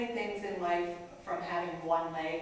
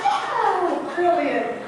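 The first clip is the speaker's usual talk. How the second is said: mock-impressed reply; 10 semitones higher, 11 dB louder, much slower than usual. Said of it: "I said".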